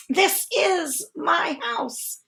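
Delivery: high, slow, near tears; tearful angry cry. Acted. Bear crying out at guests, upset and tearful. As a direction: plead it through a breaking voice. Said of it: "lets us know how to read it".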